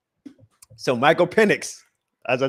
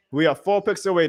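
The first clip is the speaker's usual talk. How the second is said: usual speaking voice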